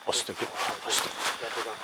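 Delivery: breathless whispered astagfirullah; whispered prayer. Real, not acted. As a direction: whisper it in fear. (bisikan doa ketakutan)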